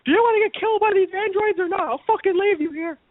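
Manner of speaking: high, frantic mock wife; shrill mock panic at her husband; panicked bystander. Bystander yelling at spouse to flee, high mock panic.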